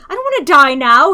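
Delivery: loud, high yell of panic; panicked yell. A narrator's reading. Arthur yelling at the guard dragging him off, loud panic. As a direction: cry out loud and high, scared of dying. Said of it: "he yelled".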